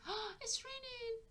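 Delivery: high-pitched gasp of delight; child's excited exclamation. Acted. Child noticing that it's raining, excited and high. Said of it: "like, she's saying".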